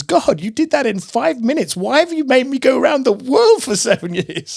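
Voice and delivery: high-pitched mock complaint to God; incredulous protest. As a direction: act out exasperated disbelief, pitch climbing.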